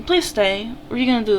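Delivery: ordinary delivery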